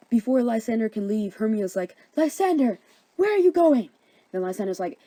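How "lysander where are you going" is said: high, loud cry after him; alarmed calling out. Acted. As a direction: call after a departing lover. Hermia calls after departing Lysander.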